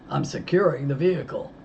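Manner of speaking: deepened voice. Retold or imitated. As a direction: try to pass as the grown deputy. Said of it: "I tried to deepen my voice".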